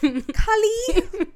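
"Cali" as KAH-lee, high and loud, laughing; alternative name pronunciation. Pretend. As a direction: playfully call "Cali" with a broad ah. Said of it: "maybe he was pronouncing it".

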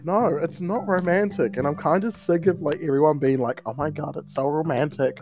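usual speaking voice

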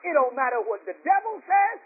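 high, driving pulpit cadence, each phrase end lifted; full-on preaching. Real, not acted. Preacher urging the congregation to be convinced, full-on preaching. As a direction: build it clause by clause like a preacher. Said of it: "I feel like preaching right now".

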